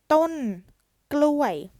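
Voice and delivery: ton gluway very high and loud; Thai word demo. Shown as a demonstration. Teacher reading banana tree in Thai, loud and high. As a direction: call out ton gluway clearly. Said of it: "And then banana tree".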